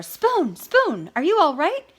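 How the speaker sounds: high, loud, worried call. A narrator's reading. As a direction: call out anxiously. Cat rushes to spoon.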